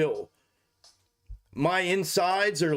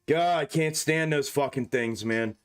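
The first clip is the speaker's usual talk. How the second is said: usual speaking voice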